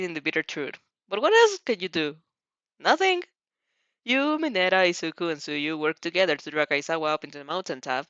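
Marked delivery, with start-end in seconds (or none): none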